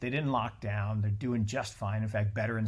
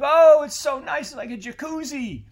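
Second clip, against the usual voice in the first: high, loud, oblivious mock-contentment; blissful frog; 13 semitones higher, 10 dB louder than usual. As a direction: play the frog enjoying the warm water, clueless. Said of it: "we're like frickin' frogs in the boiling pot".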